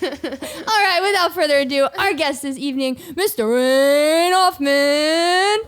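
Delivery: booming slow announcer intro; fighting game announcer. Shown as a demonstration. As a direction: build it up like a ring announcer. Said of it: "My announcer voice is actually pretty".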